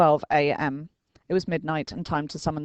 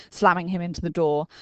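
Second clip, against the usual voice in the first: ordinary delivery, nothing marked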